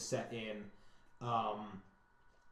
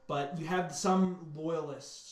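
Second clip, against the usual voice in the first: normal talking voice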